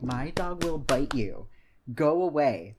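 imitating a firm warning to back off; mock warning shout. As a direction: call out a stern warning to a dog owner. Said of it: "And I'm like".

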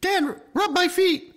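high, slow falsetto nagging wife; man doing a woman's voice. Retold or imitated. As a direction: drawl it out in falsetto. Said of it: "the way you just characterized her voice".

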